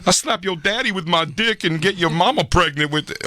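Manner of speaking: high, slow crude Tracy voice; Tracy Morgan impression. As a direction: drawl out the filthy bits as Tracy. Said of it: "Tracy went from like the".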